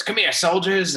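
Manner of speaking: high, loud commanding character call; actor's in-character soldier voice. Retold or imitated. Actor greeting young fans in character, loud high character voice. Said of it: "in the voice, he goes".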